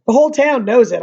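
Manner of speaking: ordinary delivery, nothing marked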